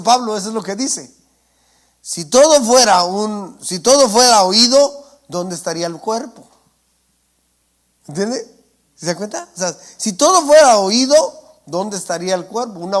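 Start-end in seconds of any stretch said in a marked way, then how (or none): 2.0-5.0 loud preaching. Real, not acted. Preacher drives home point. 10.0-11.5 loud preaching. Real, not acted. Preacher repeats point forcefully.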